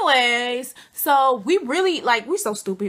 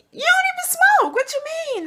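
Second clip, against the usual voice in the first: incredulous scoff; 5 dB louder than usual. Retold or imitated. Scoffing at cousin wanting weed.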